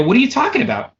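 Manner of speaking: retold question pitched high; puzzled protest, acted out; incredulous. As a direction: question it in mock disbelief. Retold disbelief at bad news.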